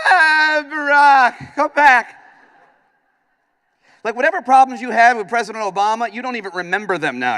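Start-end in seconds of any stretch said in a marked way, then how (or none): 0.1-2.2 high, loud hammed-up wail; mock crying plea. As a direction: beg him back through fake sobs. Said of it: "you're like".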